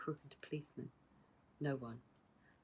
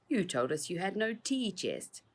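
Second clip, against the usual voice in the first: higher sneering accusation; sneered; 6 semitones higher than usual. Acted. Patrick sneering at the children, sneering. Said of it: "sneered Patrick".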